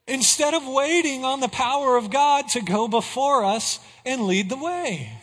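ordinary delivery

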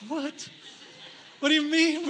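high, loud retold outburst; mock-alarmed disbelief. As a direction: act out a sudden, incredulous reaction. Dismay at learning gas is low.

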